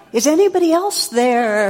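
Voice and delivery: loud, high call into the distance; hammed-up desperate shout for someone else; calling out for help. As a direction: holler upward, comically desperate. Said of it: "And the guy goes".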